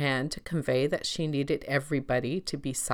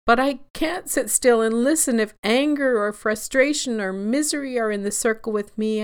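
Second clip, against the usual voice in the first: higher and louder anxious wail; 9 semitones higher, 7 dB louder than usual. A narrator's reading. Anxiety fears sitting with anger, wailing. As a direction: let the panic rise into a wail. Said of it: "Anxiety wailed".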